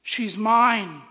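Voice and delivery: very loud, high call-out; shouted claim. Retold or imitated. Man outside crowd claims rejected woman, shouting emphatically. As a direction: call out over a booing crowd. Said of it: "a man stood and says".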